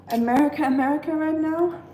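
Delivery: playful emphatic America. Real, not acted. Gushing about patriotic store displays.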